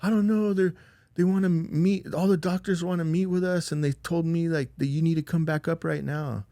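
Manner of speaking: high-pitched imitation of a distraught caller; hysterical distress. Sarah relays the doctors' summons, imitated distress. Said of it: "crying hysterically".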